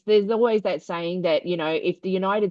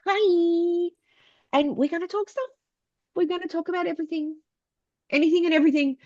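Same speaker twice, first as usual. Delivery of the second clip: bubbly show intro; 11 semitones higher than usual. Real, not acted. Bubbly podcast intro greeting.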